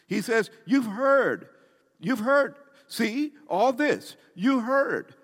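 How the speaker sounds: high, loud fervent preaching; full-force preaching. Acted. Preacher pressing God's words on the congregation, high and loud. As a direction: lift it up and drive it at the congregation.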